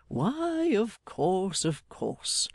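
high start tumbling down in agreement; a touch of hearty assent, falling away. A narrator's reading. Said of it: "assented Mister Meagles".

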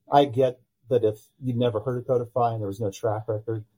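normal talking voice

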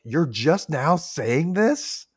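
slow, rising mock disbelief; incredulous. Julia confronting Martin over the trap, imitated incredulity. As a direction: stretch it out into an indignant question. Retold character's incredulous reply.

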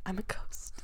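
breathy dramatic whisper. Pretend. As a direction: whisper it like a ghost. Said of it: "read it dramatically".

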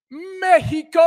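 announcer-style shout-out; hyped shout. Real, not acted. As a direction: belt the place name like an announcer. Hype shout of location.

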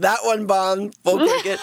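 high, smug heckler voice; mock heckler voice. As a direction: gloat at a bombed joke, way up high. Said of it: "I wouldn't go to a comedy show and be like".

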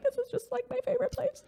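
very high, soft, tearful squeak; mock awed crying. Recalling the English countryside, teary high voice. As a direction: gush through tears in a tiny voice. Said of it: "I was in awe and just being like".

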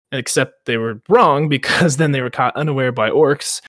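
usual speaking voice

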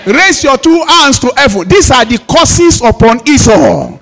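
loud, rhythmic prayer leading; preacher calling out blessings over the congregation; fervent prayer. Acted. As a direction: lead the church in declaring prayer.

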